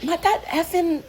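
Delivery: high, drawn-out exasperated outburst; irritated. Retold or imitated. Woman reacting to her dad's question, irritated. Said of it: "I was just so irritated".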